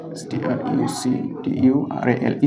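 teacher spelling a word for notes; spelled out letter by letter. Shown as a demonstration. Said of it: "একটা বললাম structural".

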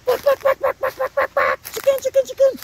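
very high, squawky mock clucking; chicken squawks. Mimicking the chickens that followed him, very high squawking. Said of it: "they're flapping their wing like".

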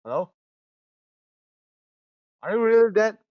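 ordinary talk, nothing marked

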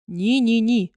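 a loud, refusing shout. A narrator's reading. Bluebeard shouting down his wife's plea. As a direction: bark a flat refusal. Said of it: "загорлав Синя Борода".

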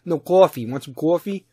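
insistent mock Jersey correction; rounded East Coast Jersey "coffee"; Jersey accent. Jersey guy correcting how coffee is said, mock Jersey accent. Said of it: "like, Jersey East Coast accent".

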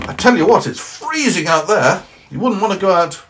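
high, loud cheery snowman. Acted. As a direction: play the indignant snowman, high and bright. Snowman voiced.

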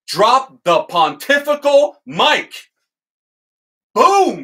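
real excitement, drawn-out then a loud Boom; triumphant. Real, not acted. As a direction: slam it home like a mic drop. Triumphant climax of the quote.

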